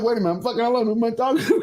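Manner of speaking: high-pitched retold bewilderment; stunned. Host retelling talking to himself alone, stunned bewilderment. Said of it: "'Cause I was so stunned".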